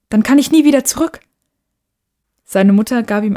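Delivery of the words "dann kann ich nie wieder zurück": put-on higher, louder worried boy; alarmed. Boy fears he is trapped, alarmed. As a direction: blurt it out in alarm.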